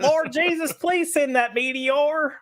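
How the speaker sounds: high, slow hillbilly preacher's plea. Acted. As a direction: beg the Lord in a hillbilly accent. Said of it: "a hillbilly accent".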